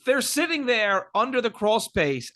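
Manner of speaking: ordinary talk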